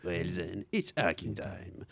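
slow, lightly voiced movie catchphrase; David Sandberg voice. Host doing his David Sandberg voice for friends. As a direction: drawl it out like the film's hero. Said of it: "ma voix de David Sandberg".

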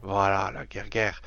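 la guerre, guerre said low and weighty; low stressed guerre. Shown as a demonstration. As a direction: drop the voice on guerre. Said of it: "la guerre sur la guerre".

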